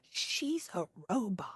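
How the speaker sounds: put-on hushed, slow little kid, voice drawn back; awed little kid. Child spots her prosthetic leg, hushed slow awe. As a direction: breathe it out like a wide-eyed child. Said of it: "they'll be like".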